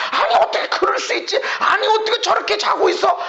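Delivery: 높은 음으로 따지는 불평; 어이없다는 듯 높여 따지기. Acted. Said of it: "원망, 불평, 탄식이 일어난다". (원망을 과장해 흉내 내는 높은 목소리)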